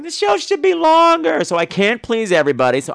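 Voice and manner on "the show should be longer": high, loud, slow put-on fan voice; mock demanding listener. Other listeners asking for longer episodes, mock plea. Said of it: "letters going".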